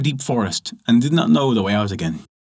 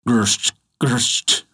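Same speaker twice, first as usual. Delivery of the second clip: grinding noise. Acted. Devil grinding the rock.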